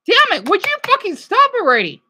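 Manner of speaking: high, loud furious yelling; yelling at controller. Real, not acted. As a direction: scream at the broken controller. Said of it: "I'm being abusive to my controller".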